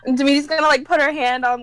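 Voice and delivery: ordinary delivery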